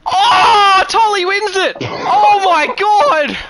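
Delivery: cracked, shouting at the top of the voice; screaming the finish. Real, not acted. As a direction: yell the winner, voice breaking with excitement. Photo finish win called.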